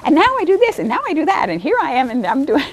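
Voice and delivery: high, eager run of mock chatter; imitating excited student. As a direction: gush like a student who can't stop talking. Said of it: "She was like".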